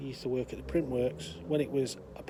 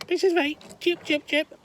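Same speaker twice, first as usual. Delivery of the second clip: very high, loud squawking parrot; 13 semitones higher, 6 dB louder than usual. Acted. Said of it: "parrot's there going".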